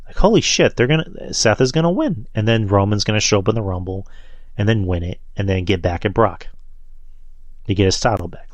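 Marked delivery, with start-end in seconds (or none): 0.2-2.3 higher, astonished inner voice; retold amazement. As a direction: relive the shock in a high voice. Voicing his shock watching the match.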